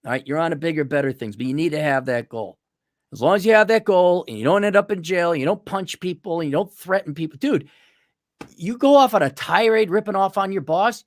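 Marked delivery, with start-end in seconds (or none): none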